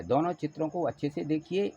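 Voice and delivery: ordinary talk, nothing marked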